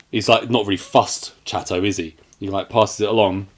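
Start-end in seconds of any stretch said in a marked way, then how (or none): none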